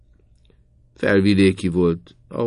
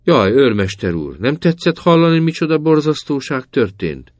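much higher, louder alarmed outcry; loud clamor; 9 semitones higher, 6 dB louder than usual. A narrator's reading. Mother crying out to the sergeant to distract him, high loud clamor. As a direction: raise a fuss to distract. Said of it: "nagy csatarászással nem szól".

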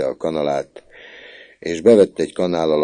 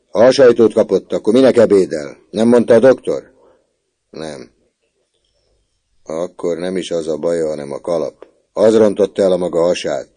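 scolding prefect; 3 semitones higher, 9 dB louder than usual. A narrator's reading. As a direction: lecture the boy, fed up. Prefect lectures boy about medicine.

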